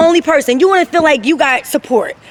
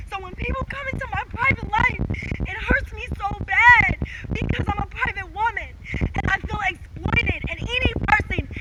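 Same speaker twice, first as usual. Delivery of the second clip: hushed hurt; 5 semitones higher, 12 dB quieter than usual. Real, not acted. Grieving mother confiding her hurt to viewers, softly pained. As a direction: drop to a soft, pained near-murmur.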